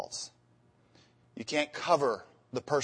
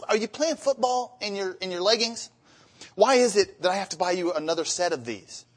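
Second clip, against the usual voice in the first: high, sarcastic complaining husband voice; irritated husband; 6 semitones higher than usual. Retold or imitated. Husband tired of errands, high and sarcastic. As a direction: poke fun at her needing more leggings.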